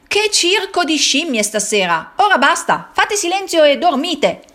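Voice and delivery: the farmer silencing the barn; angry farmer shouting. Acted. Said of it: "Il contadino apre la porta e esclama". (contadino che urla esasperato)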